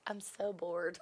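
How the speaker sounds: queen stuck alone, muttering in mock boredom; bored mumble. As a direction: sigh it out under your breath, bored stiff. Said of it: "She's like".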